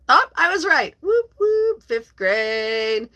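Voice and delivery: high, whooping celebration; playful cheer. Real, not acted. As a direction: whoop it up over a correct guess. Celebrates guessing the grade correctly.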